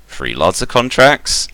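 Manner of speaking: puzzled. Acted. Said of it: "looks at her a bit oddly and goes".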